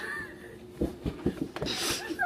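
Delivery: squealing cackle of laughter; high shrieking laugh. Real, not acted. Reader losing it over the fan fiction, high shrieking laugh.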